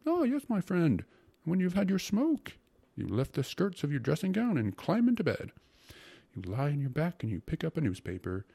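effusive, rapturous character voice; rhapsodizing secretary. Acted. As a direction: get carried away painting the fantasy.

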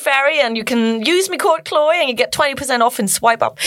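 Chloe Ferry. Acted. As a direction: Geordie influencer plugging a discount code. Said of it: "I'm better at the Geordie one".

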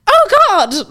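high, loud mock-horrified exclamation; appalled. Speaker imagining an influencer hearing their accent, appalled. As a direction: squeal it as if scandalised. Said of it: "she'd just be appalled by me accent".

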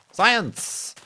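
big high proclamation of science; excited exclamation. Acted. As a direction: belt it out like a showman. Mock-grand exclamation.

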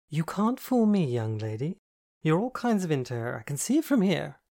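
high, loud, triumphant teasing; knowing tease. A narrator's reading. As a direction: call her bluff gleefully.

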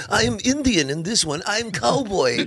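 put-on high, accented movie star; Jackie Chan. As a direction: declare it in put-on Chinese-accented English. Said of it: "Jackie Chan's drunken master".

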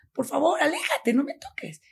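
high, frightened pleading; retold panic. Girl begging her friend to back away, panicked. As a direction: re-enact fending someone off in distress. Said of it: "me pongo a llorar despavorida".

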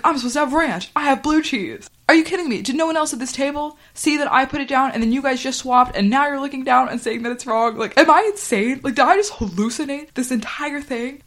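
a retold customer blowing up about the wrong sauce; indignant rant. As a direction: rant like you can't believe what happened. Customer complaining at waiter.

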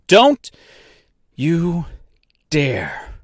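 high, loud first word, then measured threat; angry, halting command; furious warning. Acted. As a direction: space each word out as a warning. Orders slot not to close.

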